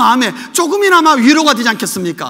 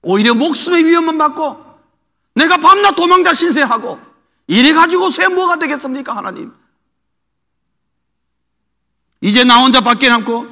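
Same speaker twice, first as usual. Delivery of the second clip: higher, louder aggrieved pleading; resentful Elijah; 4 semitones higher, 4 dB louder than usual. Retold or imitated. Preacher voicing Elijah's resentful complaint to God, aggrieved. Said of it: "원망 섞인 내용이 나오는 것입니다".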